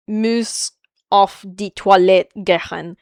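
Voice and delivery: slow, guttural German; deliberate German with guttural consonants; guttural German accent. Shown as a demonstration. Showing chat some bastardized German, guttural German accent. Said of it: "You have the guttural part of the accent down".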